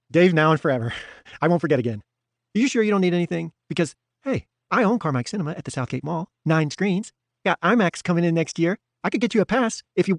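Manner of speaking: selling himself hard to a new friend; overeager Hoff. A narrator's reading. Hoff brags and offers favors.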